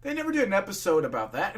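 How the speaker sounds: plain reading voice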